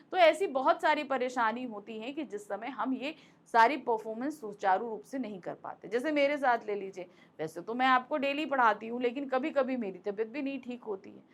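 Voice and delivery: usual speaking voice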